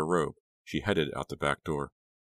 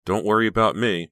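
yawning; 5 semitones higher, 6 dB louder than usual. A narrator's reading. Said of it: "Becca said, yawning".

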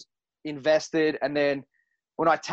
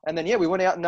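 ordinary talk

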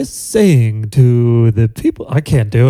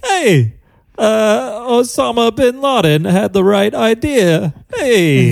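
Christopher Walken impression; 7 semitones higher, slower than usual. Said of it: "He always does that, hey".

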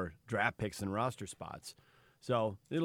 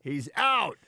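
higher, louder mock shout; mock yelling; 7 semitones higher, 6 dB louder than usual. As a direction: yell it like a blaring injury report. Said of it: "like they're yelling at you".

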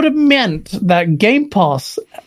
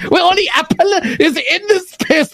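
high, lilting Indian accent impression; playful CEO impression with Indian accent; 5 semitones higher than usual. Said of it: "he wants me to do an Indian accent".

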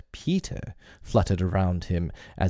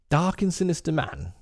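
put-on boyish defiance; 9 semitones higher than usual. Peter defies Hook.

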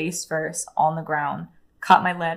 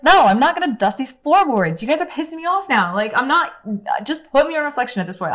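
much higher, loud, pitched-up outraged yell; furious refusal; 9 semitones higher, 6 dB louder than usual. Retold or imitated. Telling the staff she won't dust, outraged and loud. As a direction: let the outrage burst out high.

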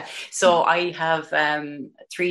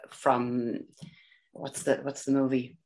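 normal talking voice

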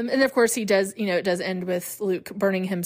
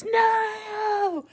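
mock scream; 12 semitones higher than usual. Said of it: "Luke screams".

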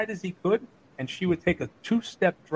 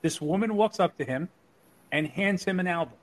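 ordinary delivery

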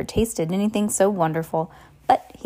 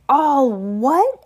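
loud, high outraged echo; thundering; 7 semitones higher, 5 dB louder than usual. A narrator's reading. Giant outraged at the boy's ignorance, thundering. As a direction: thunder it back as a rising question. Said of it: "Hagrid thundered".

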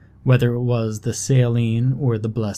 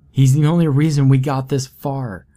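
louder, urgent insistence; insistent raised voice; 4 semitones higher, 6 dB louder than usual. Acted. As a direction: refuse firmly, close to tears. Boy insists on rescuing brother.

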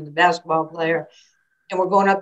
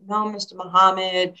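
imitating her modest younger self; mildly bashful reply. Nervous young athlete answering her idol, mildly bashful. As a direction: answer politely, a touch nervous.

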